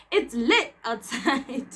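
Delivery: slow words through laughter; laughing. Retold or imitated. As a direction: laugh it out slowly. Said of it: "O re".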